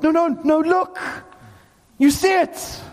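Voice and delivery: high-pitched, insistent interruption; urgent insistence. Acted. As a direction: break in urgently, demanding attention. Acting urgent call to look.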